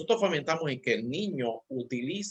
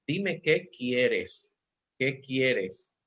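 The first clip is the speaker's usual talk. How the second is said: put-on slow calm to child; much slower than usual. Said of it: "parent asks toddler calmly".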